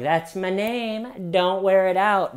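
high, drawn-out sing-song taunt; slow, lilting mockery. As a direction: imitate a glib tease, pitched well up. Mimicking the taunting suspect again.

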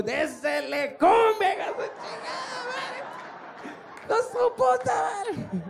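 very high, drawn-out Spanish cursing; hammed-up furious dad shriek. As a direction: stretch the curses out in a high mock yell.

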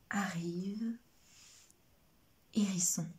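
soft unvoiced hush; suspense whisper. A narrator's reading. Narrator whispers hedgehog's surprise arrival to children. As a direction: hold the breath for the arrival.

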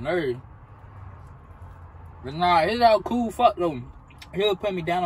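eating between words; talking while chewing. Real, not acted. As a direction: mouth partly full of food. Seen 0: bit food at -2, jaw busy, holding food throughout.

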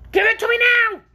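loud, piercingly high creature shriek; demanding. A narrator's reading. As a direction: demand it shrilly as the hungry orc. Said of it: "demanded the orc".

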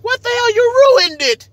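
hammed-up high whiny complainer; mocking impression. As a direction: mimic a fussy diner whining about his steak. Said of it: "y'all be like".

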